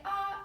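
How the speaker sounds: saying ahh. Shown as a demonstration. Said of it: "have the patient say".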